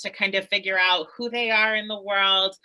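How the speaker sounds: normal talking voice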